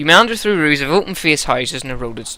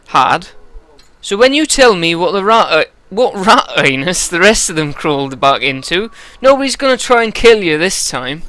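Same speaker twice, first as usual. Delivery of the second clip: higher, louder West Country menace; threatening West Country Eirik; 6 semitones higher, 6 dB louder than usual. Acted. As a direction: lean on the informant in a West Country burr. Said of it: "I think West Country for this guy".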